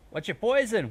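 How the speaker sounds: loud, high called-out question; calling out. A narrator's reading. Heckler interrupting a confession, called out high and loud. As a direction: call it out from the crowd. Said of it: "one called".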